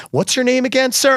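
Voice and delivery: high-pitched mimicked snub; mock polite snub. Acquaintance pretending not to know him, high mock-polite voice.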